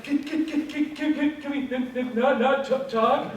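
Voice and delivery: high, sobbing stutter begging to stop; mock tearful stammer. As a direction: plead through fake tears, voice up. Crying patient begs doctor stop chatting.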